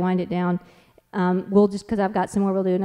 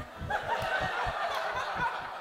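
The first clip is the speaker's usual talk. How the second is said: laugh; 11 dB quieter than usual. Real, not acted. Laughing at own story.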